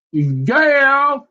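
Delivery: very high, drawn-out yeah; exclaimed yeah. Acted. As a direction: jump high on the yeah and hold it. Repeats his exaggerated yeah.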